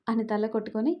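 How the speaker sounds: plain reading voice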